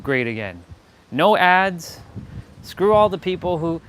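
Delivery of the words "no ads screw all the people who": excited. Pretend. Trillionaire rant promises.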